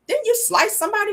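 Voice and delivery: incredulous. Retold or imitated. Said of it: "I was like".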